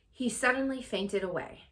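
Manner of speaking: ordinary delivery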